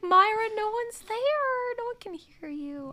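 high, put-on pitying voice; mock pity. Host telling the stranded girl she's alone, mock pity. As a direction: break it to her in a high, sorry voice.

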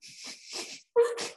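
high, airy giggle; high laughter. Real, not acted. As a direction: burst into a voiceless giggle. Amused by the story.

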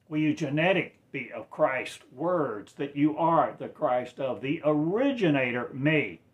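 loud, high sermon cry; impassioned pulpit delivery; fervent preaching. Acted. Sermon climax, loud declarations.

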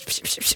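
soft, airy sprinkling sound; little hushed bursts like sprinkled glitter; imitated sparkle noises. Imitates sparkles being thrown about.

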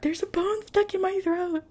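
high-pitched, hammed-up distress; comic high-voiced plea for help; mock panicked wake-up. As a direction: whine it like waking a parent in a panic. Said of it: "imagine you are waking your fucking parent".

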